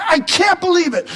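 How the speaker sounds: high, exasperated disbelief. Acted. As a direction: protest as if it's unthinkable. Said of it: "he's just shaking his head".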